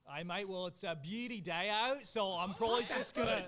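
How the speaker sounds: loud, relaxed put-on Australian accent; confident mock-Australian accent. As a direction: lay on a broad Aussie accent. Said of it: "in your best Australian accent".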